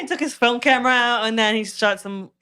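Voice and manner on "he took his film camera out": ordinary delivery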